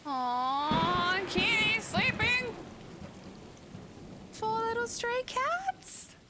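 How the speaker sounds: very high, slow cutesy coo. Real, not acted. Cooing at sleeping kittens.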